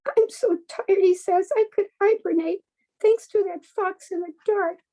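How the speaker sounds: high, tiny pleading mouse voice; little mouse voice. A narrator's reading. Adult reading a bedtime story, voicing the mouse: high and small. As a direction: squeak it out like a small scared mouse.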